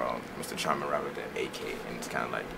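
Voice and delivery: ordinary talk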